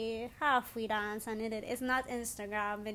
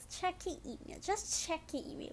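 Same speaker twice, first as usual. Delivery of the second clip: hushed urging; 7 semitones higher, 7 dB quieter than usual. Retold or imitated. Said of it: "someone was like".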